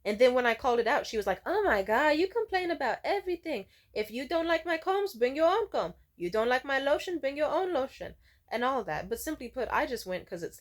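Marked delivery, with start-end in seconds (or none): 1.4-8.1 higher-pitched put-on whine of a fed-up stylist; mock exasperated complaint. Stylist griping at a picky client, mock exasperated. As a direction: imitate her griping, pitched up.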